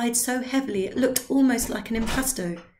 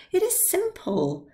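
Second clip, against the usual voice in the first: high, slow, drawn-out assurance; lightly voiced tipsy confidence; insistent old man; 11 semitones higher than usual. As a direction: wave away his doubts. Drioli insisting.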